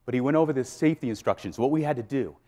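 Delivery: usual speaking voice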